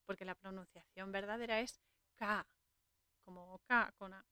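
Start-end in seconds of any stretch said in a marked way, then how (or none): 2.2-2.5 "K" said as a clipped ka sound; true pronunciation. Shown as a demonstration. The monk correcting how Car says his name. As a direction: say "K" short, as the name really sounds. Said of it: "debería pronunciarlo bien".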